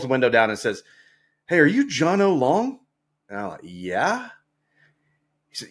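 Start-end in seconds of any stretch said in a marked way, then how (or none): 1.5-2.8 higher-pitched retold question from a passing driver; lightly acted, curious hail; calling stranger. Driver calls from van window. 3.6-4.3 hesitant, drawn-up questioning yeah; retold unsure reply, lower start swinging upward; wary yes. Wary answer to stranger.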